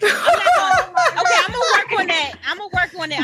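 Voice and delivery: laughing high and loud, halting, then quick. Real, not acted. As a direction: laugh through a sheepish promise. Laughing after son's rebuke.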